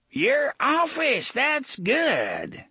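high, slow, mocking old codger; amused old man. A narrator's reading. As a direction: savour the joke in a high voice.

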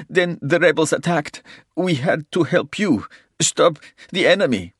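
high, loud, whimpering plea; whimpering confession. A narrator's reading. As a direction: whimper through the whole confession. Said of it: "whimpered and said".

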